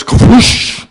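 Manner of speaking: high hissed whoosh of water; rushing water whoosh. Acted. Kids voicing a plumber, hissed water whoosh. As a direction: hiss it out like a flushing pipe. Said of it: "The plumber goes".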